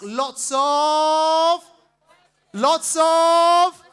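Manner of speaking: loud, high, long-held word; drawn-out rising shout; audience prompt. Real, not acted. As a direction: stretch it out and wait for the crowd. Prompting audience to finish the phrase.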